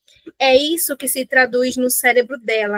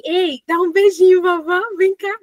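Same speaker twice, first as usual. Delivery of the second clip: higher, louder coaxing grandma voice; sing-song it like a grandma begging a kiss; playful grandma; 4 semitones higher, 4 dB louder than usual. Retold or imitated. Grandma coaxing her grandson for a kiss, playful and higher. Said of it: "Ela chega e faz assim".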